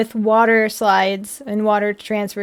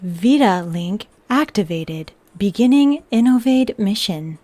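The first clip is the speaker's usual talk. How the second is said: flat machine monotone; lightly voiced robot; 2 semitones lower than usual. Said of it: "I will say in the robot voice".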